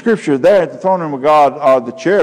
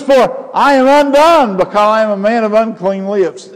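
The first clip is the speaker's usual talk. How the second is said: much higher, louder desperate cry; anguished confession; 8 semitones higher, 4 dB louder than usual. Acted. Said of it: "He says".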